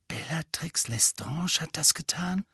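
hushed, high, rising disbelief; horrified whisper. A narrator's reading. Hermine hearing who tortured Neville's parents, in a horrified whisper. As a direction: keep it down, aghast. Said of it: "flüsterte Hermine entsetzt".

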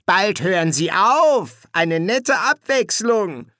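high, slow, loud relish; slow, strong villain's voice; gloating demon. Acted. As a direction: drag it out gleefully at a high pitch. Ligur grins and gloats.

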